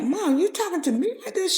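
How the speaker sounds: can't believe what mom just said; incredulous. Retold or imitated. Daughter stunned by mom's words.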